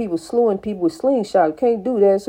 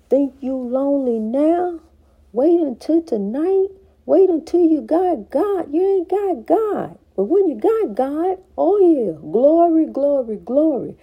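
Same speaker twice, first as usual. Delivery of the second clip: preaching; 4 semitones higher than usual. Acted. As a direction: build the fire and shout glory. Preaching climax.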